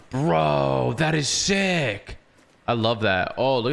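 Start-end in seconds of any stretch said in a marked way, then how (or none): 0.0-2.2 awed excitement. Real, not acted. Awed by the game's view.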